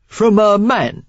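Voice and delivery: a sly London guess; Cockney stranger. A narrator's reading. Stranger guessing why Sarah hides, knowing Cockney. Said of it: "a healthy dose of Cockney in his accent".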